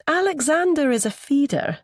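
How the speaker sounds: high-pitched Anglo-Scottish woman; Anglo-Scottish accent. A narrator's reading. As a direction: introduce yourself in an Anglo-Scottish lilt. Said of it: "in that same Anglo-Scottish accent".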